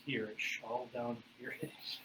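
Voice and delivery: normal talking voice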